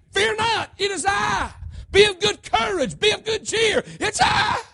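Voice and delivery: high, forceful declaration as the Lord; building, emphatic shouts of divine authority; thunderous preaching. Acted. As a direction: proclaim it at full pulpit force.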